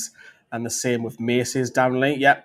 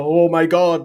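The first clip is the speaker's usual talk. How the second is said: louder, higher mock fan hype; imitated transfer-rumour frenzy; mock excitement; 5 semitones higher, 8 dB louder, slower than usual. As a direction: exclaim like an overexcited fan, hammed up. Acting out fans' excitement over rumour.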